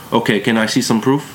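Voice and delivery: a hint of a strong German accent. A narrator's reading. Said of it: "She had a strong German accent".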